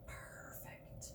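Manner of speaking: whispered reveal; hushed, savoring whisper. Acted. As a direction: drop to a whisper on perfect. Whispered punchline word.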